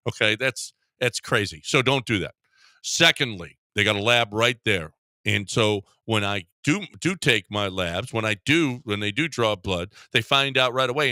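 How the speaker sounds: upbeat host-read sponsor spot. Acted. Radio host reading a live spot for Game Day Men's Health. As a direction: sell it like a radio ad.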